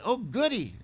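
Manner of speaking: high mock-delighted child; imitated little girl. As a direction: act out a small kid's glee. Acts five-year-old daughter's reaction.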